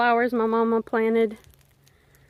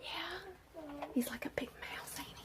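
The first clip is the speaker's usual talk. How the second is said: whispering; 4 semitones higher, 24 dB quieter than usual. Real, not acted. Whispering near a wild animal.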